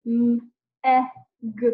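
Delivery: M, e, g sounded one at a time; letter sounds. Shown as a demonstration. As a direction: tap out M ... e ... g with clear gaps. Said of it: "tap out the sounds".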